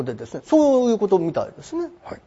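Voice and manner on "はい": ordinary talk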